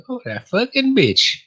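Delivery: high, slow, put-on indignant voice; mock outrage. Retelling his reaction to Natalie, high slow mock outrage. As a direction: stretch it out in a mock-scandalised squeal. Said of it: "I was like".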